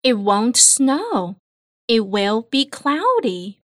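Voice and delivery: ordinary delivery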